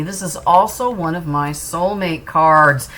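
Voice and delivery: ordinary delivery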